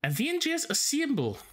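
high, hammy Kiwi shout of assemble; squeaky New Zealand accent. Pretend. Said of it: "my bad Kiwi accent".

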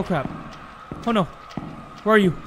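ordinary delivery

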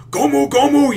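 high, loud anime battle shout; shrill Gomu Gomu battle cry; Luffy battle cry. Retold or imitated. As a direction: wind up the punch, yelling it out. Luffy winding up his attack.

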